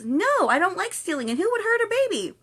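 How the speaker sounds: loud, high-pitched protest; mock shocked refusal. Friend refusing a bad teddy bear, mock outrage.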